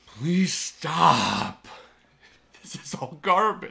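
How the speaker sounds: loud, fed-up plea in imitation; mock exasperated complainer. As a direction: call it out like an online grumbler. Voices fed-up Facebook complainers.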